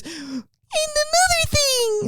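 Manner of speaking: put-on high, slow whiny baby talk; a world leader whining in baby talk; cutesy baby voice. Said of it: "world leaders".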